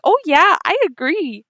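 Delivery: high, sing-song agreement; eager agreement. Acted. Chandra chiming in eagerly.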